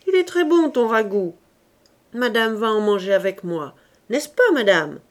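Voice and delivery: loud, high husband talking through food; jolly husband eating and chiding his wife; mouth full, jovial. A narrator's reading. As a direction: boom at her while chewing. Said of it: "dit Coupeau la bouche pleine".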